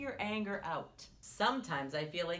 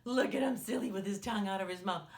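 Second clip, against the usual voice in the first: ordinary talk, nothing marked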